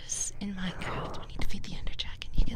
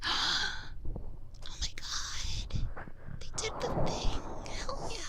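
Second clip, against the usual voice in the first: whispered delight, last words jumping up high; mock excited whisper; slower than usual. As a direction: gush like a kid who got what they wanted, still whispering. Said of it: "And I was like".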